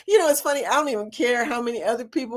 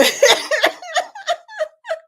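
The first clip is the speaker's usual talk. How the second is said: high giggling laugh; 3 dB louder than usual. Real, not acted. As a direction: giggle helplessly. Still laughing at stickers.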